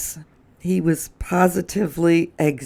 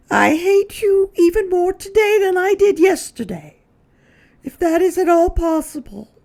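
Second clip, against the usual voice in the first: much higher lady's voice; Missus Prim reading; 5 dB louder than usual. Acted. Missus Prim reading the second note aloud. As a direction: read it out in a fretful lady's voice. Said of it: "read Missus Prim".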